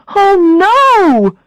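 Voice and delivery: loud, high oh no of panic; realizing she's at the wrong restaurant, dismayed; dismayed cry. Acted. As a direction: cry out as the mistake hits. Coins spill everywhere.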